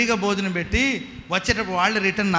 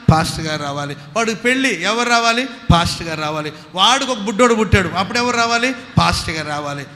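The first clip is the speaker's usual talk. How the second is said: preaching at full force; 4 semitones higher, 7 dB louder than usual. Acted. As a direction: drive the congregation with call and response, building. Call-and-response preaching. (పూర్తి ఉత్సాహంతో బోధించే స్వరం)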